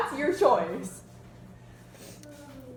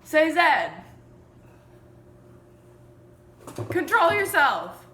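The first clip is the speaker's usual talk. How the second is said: loud teasing; 5 semitones higher, 11 dB louder than usual. Real, not acted. Teasing mom on camera, laughing.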